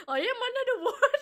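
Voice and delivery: giggling through the line as mock voice acting; laughing while voice acting. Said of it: "Voice acting practice".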